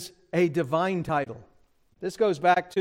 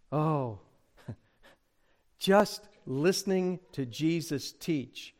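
ordinary delivery

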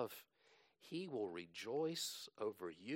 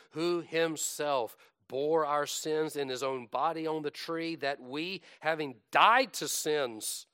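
louder, rising scripture declamation; impassioned preaching; 5 semitones higher, 9 dB louder than usual. Acted. Preacher declaring the atonement to his congregation, impassioned. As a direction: swell with conviction, pitch lifted.

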